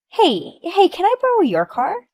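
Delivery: high, loud wheedling; pushy demand. Acted. Jealous neighbor pushing to borrow the car, brazen.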